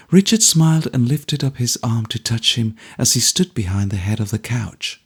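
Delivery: ordinary delivery, nothing marked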